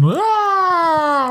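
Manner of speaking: high, drawn-out mock scream. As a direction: wail like a spinning sonic scream. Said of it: "she just spin around in a circle and screaming?".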